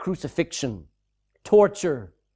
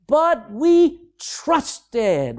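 "But we trusted" drawn out very slowly and a bit higher; stress on past tense; 5 semitones higher, 4 dB louder, much slower than usual. Shown as a demonstration. Preacher pointing out the past tense to his congregation, very slow. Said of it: "What's wrong with that statement?".

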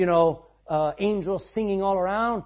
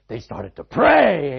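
loud and slow; a preacher driving home the moment; preaching peak; 3 semitones lower, 11 dB louder, much slower than usual. Real, not acted. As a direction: stretch it out and hit it hard. Preacher's climax of story.

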